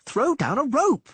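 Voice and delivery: high, loud pleading yell. Acted. Dandelion yelling at the others for a rope, high and loud. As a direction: yell it at them. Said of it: "Dandelion yelled".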